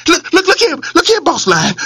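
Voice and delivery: high, hurried pleading rabbit voice. Acted. Rabbit begs the angry lion, pleading. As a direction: beg for your life, stumbling. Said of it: "he pleaded".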